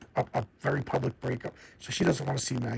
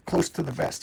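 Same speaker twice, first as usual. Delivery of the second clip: usual speaking voice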